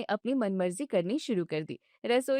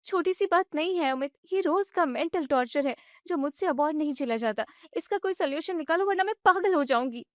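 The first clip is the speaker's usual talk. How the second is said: higher, fast, agitated wife; upset young wife; 6 semitones higher than usual. Acted. Wife vents to her husband about his mother, agitated and high. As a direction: vent it all at once. Wife near breaking point.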